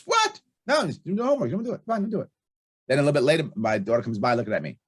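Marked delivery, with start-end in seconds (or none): 0.1-0.4 much higher incredulous yelp; imitating wife's shocked disbelief; wife hearing no homework, shrill high disbelief; mock shocked what. 0.7-2.3 imitating annoyed wife.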